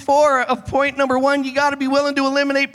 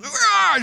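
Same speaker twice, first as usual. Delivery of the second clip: angry roar; 11 semitones higher than usual. Retold or imitated. Man erupts in anger.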